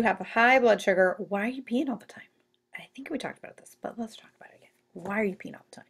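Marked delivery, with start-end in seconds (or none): 1.3-2.2 unvoiced whisper, mock secret; whispered question to the class; hushed and breathy. 2.8-5.9 unvoiced stage whisper; hushed conspiratorial aside.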